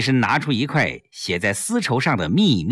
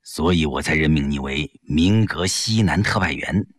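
put-on lower, measured old leader's voice; elderly statesman; 9 semitones lower than usual. Li Jishen confiding to Yang Jie, low and measured. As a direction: speak like a senior statesman confiding.

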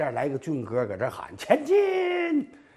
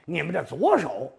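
plain reading voice

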